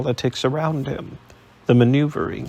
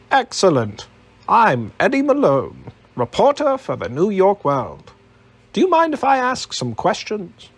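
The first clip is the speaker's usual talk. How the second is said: higher, bright-voiced American reporter; eager American accent; 8 semitones higher, 3 dB louder than usual. A narrator's reading. Reporter introduces himself to Alec, eager American accent. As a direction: pitch it up, keen New York reporter. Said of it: "The man's accent was unfamiliar".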